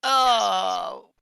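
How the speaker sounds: high, loud drawn-out cry; loud exclamation. Acted. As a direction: let it burst out loud and high. Acting Dewey's excited relief.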